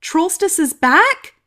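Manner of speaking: high, loud, slow disbelief; can't believe the good news; surprised. Acted. King hearing trollstice returns, amazed.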